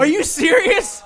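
high, loud, slow disbelief; stretched-out stunned question; shocked. Real, not acted. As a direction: gasp it out in mock shock. Co-host admits a DUI.

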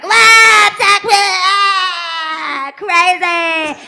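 loud, high bar-photo squeal; party girl. Retold or imitated. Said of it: "being like".

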